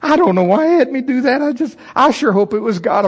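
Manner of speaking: embarrassed, trying to explain; high nervous excuse; half apologizing. Retold or imitated. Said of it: "I'm kind of half apologizing".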